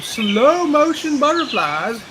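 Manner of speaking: slow, high, drawn-out words; loud, playful slow motion. Pretend. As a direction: stretch it like slow-motion footage. Stretches words to mimic slow motion.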